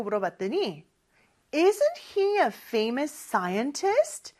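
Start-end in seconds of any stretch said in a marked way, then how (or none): 1.5-4.3 very slow, rising question back; slow model question. Shown as a demonstration. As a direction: ask back slowly, clearly rising. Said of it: "또 이렇게 되물었어요". (천천히 되묻는 시범 문장)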